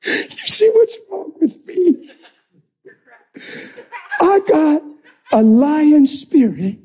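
high, excited laughing preach; joyful preaching. Real, not acted. Preacher laughing in triumph before the congregation, high and excited.

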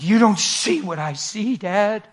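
high, loud, slow tearful pleading; rising into a cry; son breaking down. Retold or imitated. Son crying to his dad, high and tearful.

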